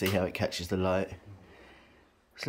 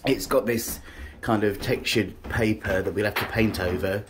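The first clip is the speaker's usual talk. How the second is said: ordinary delivery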